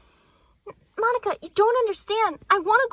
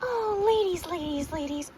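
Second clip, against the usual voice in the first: usual speaking voice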